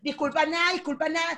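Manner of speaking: high, insistent mock refusal; picking a fight. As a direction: talk right over them, acting offended. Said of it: "búscame peo en un en vivo".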